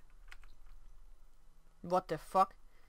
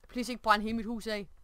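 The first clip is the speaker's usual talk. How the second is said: ordinary talk, nothing marked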